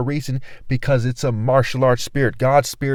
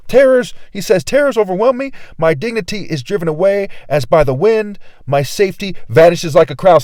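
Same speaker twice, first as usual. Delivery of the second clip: forceful preaching; 2 semitones higher, 4 dB louder than usual. Acted. Preacher proclaiming Job's lament to his viewers, loud. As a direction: proclaim the verse at full force.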